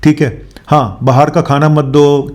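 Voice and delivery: ordinary delivery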